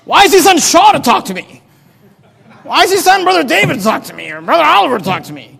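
acted high-pitched complaint; mock-offended protest at being sent underlings; indignant anger. Naaman griping about being sent a staff member, indignant. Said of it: "He's all angry".

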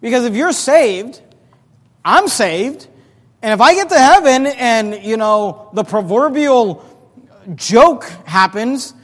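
loud, high fervent sermon; full-force preaching. Acted. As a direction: preach it out to the congregation.

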